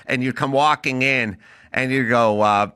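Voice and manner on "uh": ordinary talk, nothing marked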